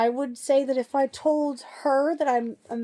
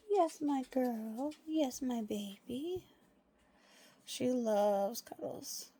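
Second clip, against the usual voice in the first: slow cooing; 9 dB quieter, much slower than usual. Real, not acted. Owner slowly cooing as dog returns. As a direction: stretch each word tenderly.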